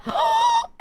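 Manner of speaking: sharp high gasp of shock. Pretend. Fencer seeing a bazooka, high shocked gasp. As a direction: gasp like you just got blindsided.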